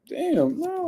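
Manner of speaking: high, squeaky disbelieving "Damn, no"; mock disbelief. Streamer reacting to the Brooklyn claim, high disbelief. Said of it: "I'm like".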